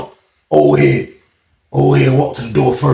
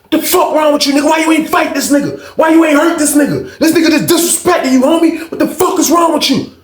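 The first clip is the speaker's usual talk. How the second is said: angry shout; 10 semitones higher than usual. Retold or imitated. Chris scolding Jay for not fighting, angry shout. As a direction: bark at him in disbelief.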